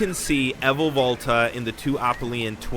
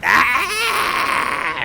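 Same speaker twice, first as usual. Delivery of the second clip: goblin shriek; 12 semitones higher, 7 dB louder than usual. Acted. Jaws waddling as goblin.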